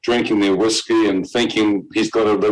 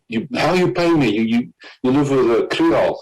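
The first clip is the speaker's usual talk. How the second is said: angry driver; 4 semitones higher than usual. Retold or imitated. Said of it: "He was angry".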